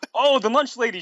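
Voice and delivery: high, delighted mock shout; happy shout. A narrator's reading. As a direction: greet her with cartoonish joy. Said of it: "shouted Tails in a happy way".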